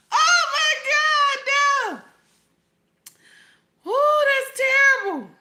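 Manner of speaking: high, stretched-out cry of disbelief; helpless laughing outburst; laughing shock. Real, not acted. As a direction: drag it out, laughing. Overcome laughing at joke.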